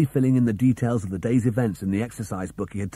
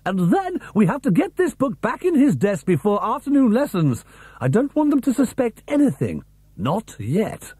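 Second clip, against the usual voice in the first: a hint of a brisk, urgent schoolteacher; Miss Taylor; 8 semitones higher than usual. A narrator's reading. Teacher urging her colleague to return the book, brisk and higher.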